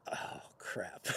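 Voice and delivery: breathless whispered panic; whispered oh crap. Retold or imitated. Caught off guard by the friend's sister, a panicked whisper. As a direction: gasp it out under your breath in alarm. Sudden panic at grabbed arm.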